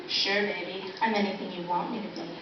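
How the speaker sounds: low, soft come-on; mock seductive. Answering whether they like women, mock seductive. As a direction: purr it quietly, teasing an admirer.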